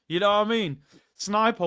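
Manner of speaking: normal talking voice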